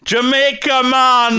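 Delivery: put-on high, loud hammy Jamaican accent; bad Jamaican accent. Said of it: "John Candy trying to do a Jamaican accent".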